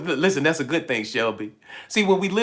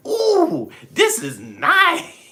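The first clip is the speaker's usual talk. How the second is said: much higher and very slow, drawn-out awe; mock wonder; 4 dB louder, much slower than usual. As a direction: marvel at it like a kid in a toy store. Soul marvelling at earth.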